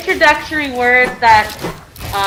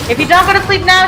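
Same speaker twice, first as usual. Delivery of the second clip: mother's voice; 9 semitones higher, faster than usual. Acted. Teacher voices mother in example sentence.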